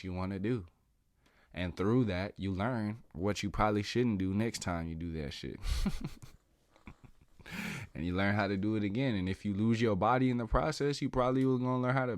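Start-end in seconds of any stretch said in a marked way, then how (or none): 5.6-6.3 short real chuckle. Real, not acted. Speaker amused by his own advice, chuckling. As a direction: let out a brief amused laugh.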